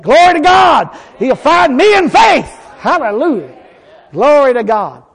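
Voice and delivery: loud, high shout of praise. Real, not acted. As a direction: shout it out to the congregation. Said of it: "Well, say it".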